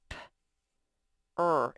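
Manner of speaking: "er" said as one loud drawn-out sound. Shown as a demonstration. Teacher to class, sounding out the er in paper. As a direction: stress "er" clearly after the P. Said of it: "one sound, er".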